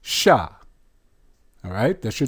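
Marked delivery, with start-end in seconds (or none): 0.0-0.5 "sha" with sh plus open ah; "sha" as one isolated falling syllable; blended syllable. Shown as a demonstration. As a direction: sound out "sha" on its own. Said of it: "which is an ah".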